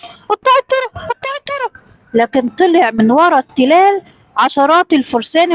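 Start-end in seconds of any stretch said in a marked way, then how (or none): 0.0-1.8 high, frightened acted whisper, dropping away; fearful whisper. A narrator's reading. Frightened travellers warning of bandits, fearful whisper. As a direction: whisper the alarm in a high scared voice. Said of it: "الناس همسوا بخوف".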